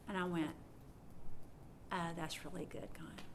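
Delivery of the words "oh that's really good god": hushed awe. Retold or imitated. Retold quiet reaction to God.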